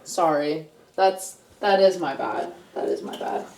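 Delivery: softly admitting fault; sheepish. Real, not acted. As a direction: mumble the apology, fading out. Sheepish apology, fading.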